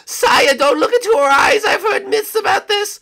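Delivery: very high loud cry; urgent warning shout. Acted.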